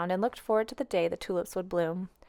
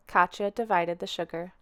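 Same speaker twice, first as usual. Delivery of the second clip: ordinary delivery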